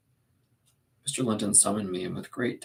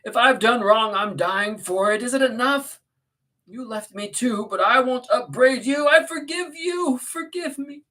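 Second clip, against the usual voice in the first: high, loud, anguished pleading; slow, high-pitched cry for forgiveness; distraught Catherine; 9 dB louder than usual. Acted. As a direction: plead through acted tears. Catherine sobbing, begging forgiveness.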